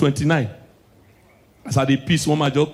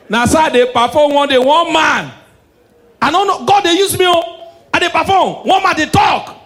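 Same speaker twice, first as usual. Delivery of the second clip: high, loud, rushing excitement; excited retelling; 9 semitones higher, 4 dB louder than usual. Retold or imitated. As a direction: tell it like you can barely hold it in. Excited retelling of the prophet joke.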